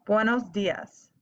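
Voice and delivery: "buenos dias" drawn out slowly for repeating; slow model to repeat. Shown as a demonstration. Teacher saying "buenos dias" for students to repeat. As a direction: say it slowly so they can echo. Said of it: "I'm gonna say it, and then I want you to repeat it".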